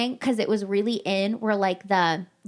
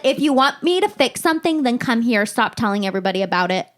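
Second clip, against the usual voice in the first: screaming across the room; 5 dB louder than usual. Retold or imitated. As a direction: call out across the room in exasperation. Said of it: "I screamed at her from across the room".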